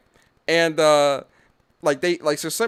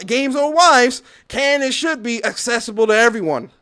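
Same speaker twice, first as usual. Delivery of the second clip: loud, higher, hammed-up parody of the tweet; mock brother reasoning; 5 semitones higher, 9 dB louder than usual. Brother justifying sharing the wife, loud mock parody. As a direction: throw the tweet's words back as the brother.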